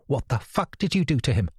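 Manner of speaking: narrator's mild mock shout of fury; shouting at her. A narrator's reading. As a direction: throw it at her like an accusation. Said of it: "I shouted at her".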